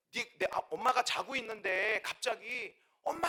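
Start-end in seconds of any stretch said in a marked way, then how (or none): 3.0-3.3 mother's sudden cry, acted out high; imitated scream. Sleeping mother suddenly cries out for her own mother, imitated scream. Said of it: "비명처럼 '엄마!' 하고 소리를 지르다는 거예요".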